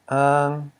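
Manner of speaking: ang held loud; stressed ang ending. Shown as a demonstration. Teacher isolates the live syllable, ang sounded out. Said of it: "plus a live syllable".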